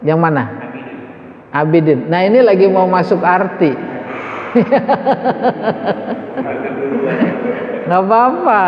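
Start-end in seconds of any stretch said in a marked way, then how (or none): 4.0-7.8 laughter. Real, not acted. Laughing at the class.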